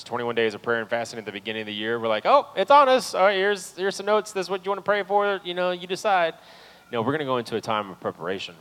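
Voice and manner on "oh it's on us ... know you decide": high, loud, offhand brush-off; mock breezy dismissal. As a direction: hand out the notes and shrug it off. Mocking church's past approach.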